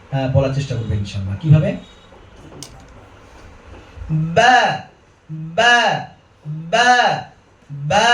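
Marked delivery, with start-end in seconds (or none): 4.1-8.1 Arabic "বা" with lightly spread lips; lips slightly spread. Shown as a demonstration. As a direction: recite "বা" three times, then draw it out. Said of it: "ঠোঁটটিকে হালকা একটু ছড়িয়ে".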